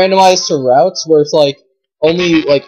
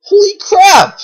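disbelief; 7 semitones higher, 4 dB louder, slower than usual. Real, not acted. Disbelief at rare find.

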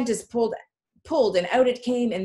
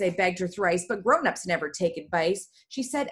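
usual speaking voice

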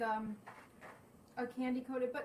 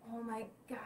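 whispering; 4 dB quieter than usual. Real, not acted. Savoring coffee spread smell.

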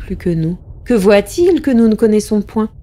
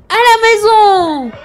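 much higher, louder, slow shouted order; loud cry; 12 semitones higher, 4 dB louder, slower than usual. Acted. Possessed narrator shouting at his coachman, a loud slow cry. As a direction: bellow the order against your own will. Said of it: "j'ai crié d'une voix si forte".

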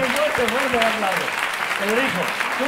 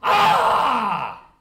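shouted grunt of effort; 2 semitones higher than usual. Shown as a demonstration. Magician showing the volunteer how to yell, shouted. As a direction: bark out a strongman's effort cry, half laughing. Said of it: "haces así y dices".

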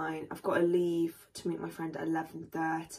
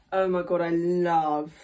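loud excited gush; 9 dB louder, slower than usual. Real, not acted. Unboxing new trackies.

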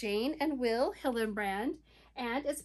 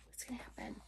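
fast suspenseful whisper; 2 semitones lower, 17 dB quieter, much faster than usual. Pretend. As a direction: whisper it like a secret. Suspense for children.

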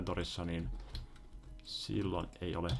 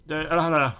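high, loud string of don'ts; alarmed; 10 semitones higher, 10 dB louder, much faster than usual. Real, not acted. Player begs game not to do it, alarmed. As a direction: protest urgently, voice jumping up.

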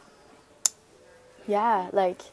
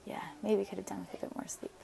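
softer, fast, tired whisper; whispered aside; 8 dB quieter, faster than usual. Real, not acted. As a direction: mutter it to yourself in a whisper. Tired aside about early chanting.

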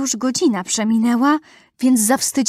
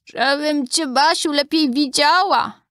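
higher, put-on wolf-as-granny voice; wolf posing as granny; 6 semitones higher than usual. A narrator's reading. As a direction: answer as a wolf faking grandmother. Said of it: "wilk mruczy". (wilk udający głos babci, wyżej)

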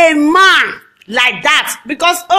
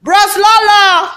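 raised-pitch taunting call; mock calling out; 3 semitones higher, 4 dB louder than usual. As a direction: holler the name as a teasing summons. Hailing Bros Lolo mockingly.

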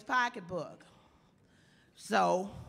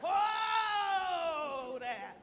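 high, drawn-out horse call; whoa there; 9 semitones higher, much slower than usual. Retold or imitated. Paul Revere calling his horse to stop, high and drawn out. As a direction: rein in the horse, long and high. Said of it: "Paul Revere goes".

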